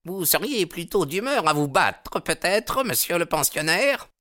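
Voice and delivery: high-pitched, seething challenge; furious. A narrator's reading. Baldwin challenging the boarder to fight, furious. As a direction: goad him into a fight. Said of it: "les yeux de monsieur Baldwin s'embrasèrent de fureur".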